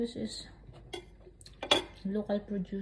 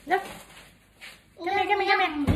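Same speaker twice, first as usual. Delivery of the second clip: loud high calling; 9 semitones higher, 8 dB louder, faster than usual. Real, not acted. Calling child over.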